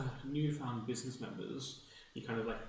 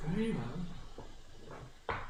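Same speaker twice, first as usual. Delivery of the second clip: slow, measured; 6 semitones higher, much slower than usual. Acted.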